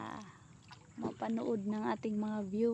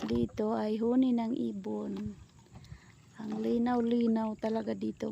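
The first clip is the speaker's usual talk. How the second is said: ordinary talk, nothing marked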